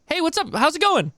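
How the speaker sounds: very high, bright phone greeting; chirpy high customer service voice. Retold or imitated. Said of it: "my customer service voice is high".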